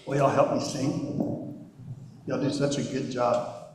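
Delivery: normal talking voice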